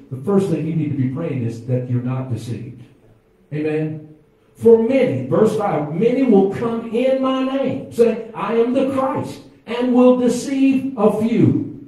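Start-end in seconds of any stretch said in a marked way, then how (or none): 4.5-11.8 high, loud, slow scripture reading; preacher reading scripture with rising fire; fiery preaching. Acted. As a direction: thunder the verse out to the room. Preaching scripture at full force.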